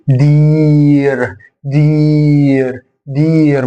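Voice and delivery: دير drawn out slowly as "deeer"; long vowel. Shown as a demonstration. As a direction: hold the ee in each دير. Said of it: "أطول أكن الياء ممدود". (دير بياء ممدودة)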